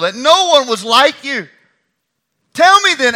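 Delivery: loud, high taunting call across the valley; mocking shout. David calling out to Abner, taunting and loud. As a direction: jeer at him from far off, voice ringing.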